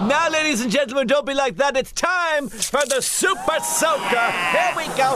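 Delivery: high, fast and excited; hyped showman. Acted. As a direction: whip the crowd up. Host hypes the water gun.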